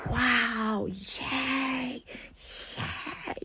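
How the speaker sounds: gasp, then breathy fake cheering; hammed-up airy "yay" after a big gasp; mock delight. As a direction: feign thrilled surprise, sarcastically soft. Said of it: "did you think I was gonna say".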